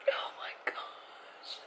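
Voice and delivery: breathless whisper after finishing; awed whisper. Real, not acted. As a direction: whisper it, overwhelmed. Just finished book, whispering.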